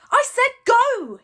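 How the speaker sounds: loud, high, slow frustrated yell; frustrated shout. Acted. Hiccup repeating the command to a stubborn dragon, frustrated shout. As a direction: force it out in exasperation. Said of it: "repeated in frustration".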